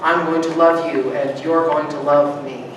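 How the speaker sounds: ordinary talk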